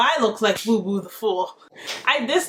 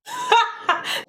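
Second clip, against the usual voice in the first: laughing; 12 semitones higher than usual. Real, not acted. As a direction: burst of high laughter. Reaction laugh at story.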